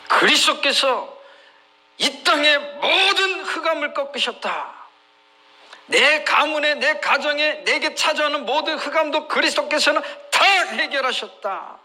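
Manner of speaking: high, declarative sermon proclamation; emphatic preaching. Real, not acted. Preacher assures the congregation of Christ's victory, emphatic and high. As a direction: proclaim it with ringing conviction.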